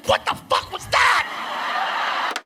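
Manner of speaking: high, slow, breathless shock; gasping what-was-that, high and slow; stunned disbelief. Retold or imitated. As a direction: drag it out in stunned amazement. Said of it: "I was like".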